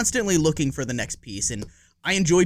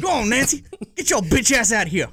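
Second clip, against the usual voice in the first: high, loud laughing preacher voice; Baby Billy voice; 8 semitones higher, 4 dB louder than usual. Retold or imitated. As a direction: taunt like a hammy Southern preacher. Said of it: "in the Baby, uh, Billy voice".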